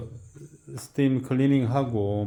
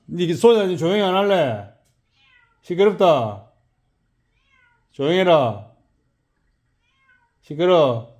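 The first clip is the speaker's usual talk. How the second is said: raised-voice telling-off, higher pitched; scolding; 4 semitones higher, 4 dB louder than usual. Real, not acted. Tells noisy girl to be quiet.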